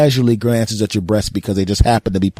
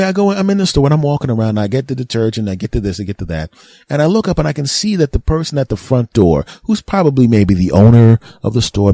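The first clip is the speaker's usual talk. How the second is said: ordinary delivery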